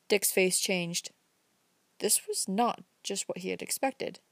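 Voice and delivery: ordinary delivery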